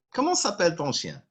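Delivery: Comment s'appelle ton chien at natural fast speed; fast native-speed question; quick natural run. Shown as a demonstration. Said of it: "What is the name of your dog?".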